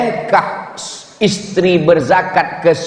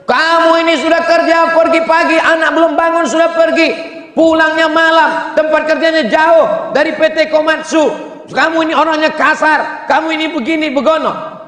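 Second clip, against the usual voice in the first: much higher shrill-pitched scolding; nagging wife; 11 semitones higher than usual. Retold or imitated. Wife nagging her husband in a much higher voice. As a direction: nag him like a fed-up wife.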